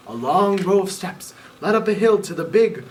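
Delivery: usual speaking voice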